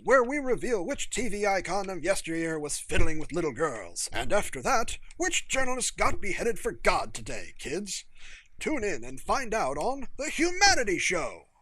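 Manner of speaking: loud, hammed-up game show host; goofy TV presenter parody. Jenkins mocks the news as a game show, loud goofy host voice. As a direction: bellow it like a cheesy TV host selling trash. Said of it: "adopted a goofy parody of a T V presenter".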